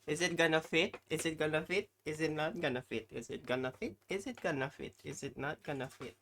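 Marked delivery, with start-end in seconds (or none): none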